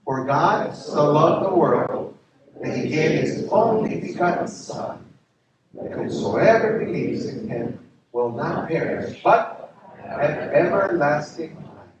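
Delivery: slow, loud, measured recital of the verse; scripture recitation. Acted. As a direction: proclaim each word slowly with the congregation. Congregation recites John 3:16.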